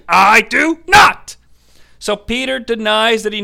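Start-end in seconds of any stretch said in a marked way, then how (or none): 0.1-1.4 slow, emphatic denial, each word punched; mock indignant denial. Peter denying that he knows Yeshua, hammed-up emphatic denial. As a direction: stretch the I and hit NOT hard.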